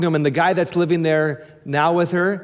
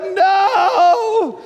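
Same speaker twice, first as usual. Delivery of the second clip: high shouted laughing no; 4 dB louder than usual. Retold or imitated. Pastor hugged by neighbors, laughing shouted no. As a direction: yelp no through a laugh. Protesting the hug.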